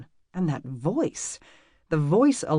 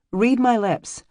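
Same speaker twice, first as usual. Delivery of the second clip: emphatic retort; 7 semitones higher, 7 dB louder, slower than usual. Acted.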